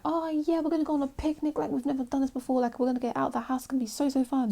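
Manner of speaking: put-on high, giddy little kid; excited kid voice. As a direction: squeal it like an excited child. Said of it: "so excited about it".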